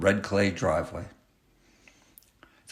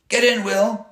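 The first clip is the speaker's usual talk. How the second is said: yelled; 13 semitones higher, 5 dB louder than usual. A narrator's reading. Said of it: "yelled his best friend Zeke".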